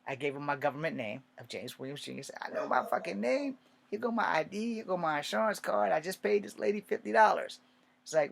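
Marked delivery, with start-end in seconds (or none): 2.4-7.6 exasperated. Retold or imitated. Fed-up patient, voiced high.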